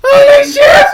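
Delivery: loud laughing exclamation; laughing shout. Real, not acted. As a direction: blurt it out mid-laugh. Laughing outburst at wrong explanation.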